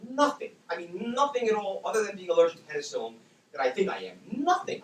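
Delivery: loud, high, emphatic retold denial; adamant denial. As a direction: deny it forcefully, hitting each nothing. Retold adamant denial to hospital questions.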